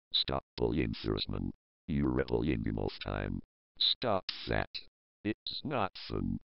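very low, flat text-to-speech drone; a speech synthesizer looping a complaint; robotic monotone voice. Acted. Robot voice repeating its plea to stop bullying, very low monotone.

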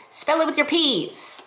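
high, campy quoted line; campy quote voice. Retold or imitated. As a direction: toss off a camp movie quote. Quoting Easy A line.

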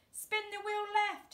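high urgent call; shouted. A narrator's reading. Dad shouting directions to his son, high. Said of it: "shouted Dad".

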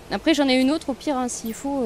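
usual speaking voice

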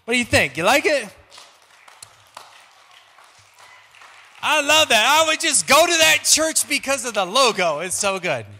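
bursting with delight at his own joke; excited. Real, not acted. Excited after the pitch.

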